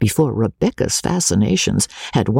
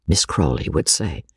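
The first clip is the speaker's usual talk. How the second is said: ordinary delivery, nothing marked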